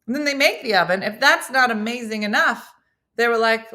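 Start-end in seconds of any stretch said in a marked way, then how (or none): none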